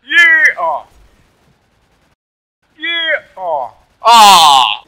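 high shouted cheer dropping into a groan; big reaction to a near miss; loud gamer outburst. Real, not acted. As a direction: yell out, then groan loudly. Cheering and groaning at game play.

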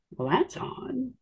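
slow, quiet puzzled aside; noticing a masked stranger, bemused. Retold or imitated. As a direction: drag it out, half to yourself.

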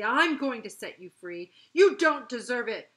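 loud, emphatic angry declaration; furious. A narrator's reading. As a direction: fling it out grudgingly and furiously. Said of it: "she said furiously".

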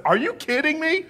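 high, loud incredulous cry; shocked disbelief. Retold or imitated. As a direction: react in total disbelief. Retold shocked reaction.

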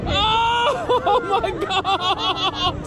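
screaming and whooping on a thrill ride; high yelps of fright and glee; on a roller coaster. Real, not acted.

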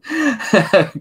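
high, loud burst of laughter; sudden loud laugh. Real, not acted. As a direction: crack up at a chat message.

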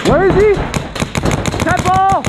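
man down shout. Real, not acted. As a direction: yell it across the field. Yelling mid-game.

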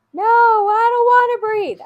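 put-on high, loud, whiny kid's refusal; child refusing. Teacher voicing an overwhelmed boy who refuses to breathe, high loud whine. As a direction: shout it like a stubborn little boy.

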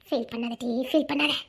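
soft consoling. Acted. Consoling the friend softly.

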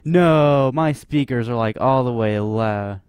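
loud protest. Real, not acted. Startled by blaring game sound, loud protest.

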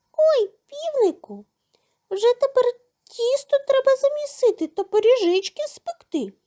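high squeaky refusal; tiny mouse shrugging it off. A narrator's reading. Mouse refuses the rooster's request, high squeak.

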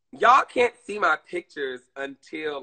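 normal talking voice

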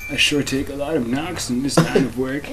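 a hint of a grumbling ghost catcher; lightly voiced weary professor. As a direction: read it as a mildly put-upon game character. Reads game dialogue in character.

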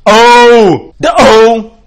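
loud, high cartoon catchphrase; drawn-out, high exclamation, belted out; Homer Simpson. Presenter voices his Homer drawing, loud cartoon cry. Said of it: "he's saying".

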